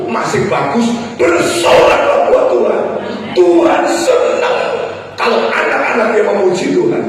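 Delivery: high, loud rallying preacher; impassioned sermon exhortation; full-force preaching. Real, not acted. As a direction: rouse the congregation to cheer for God.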